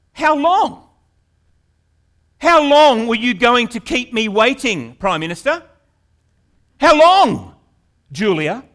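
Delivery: loud, high, indignant challenge; disrespectful demand. Pretend. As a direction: demand it rudely of the Prime Minister. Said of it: "if someone spoke like that to our Prime Minister".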